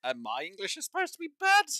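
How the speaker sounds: high, loud indignant question; incredulous retort. Retold or imitated. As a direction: throw it back at them, rising high in disbelief. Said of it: "turn around and go".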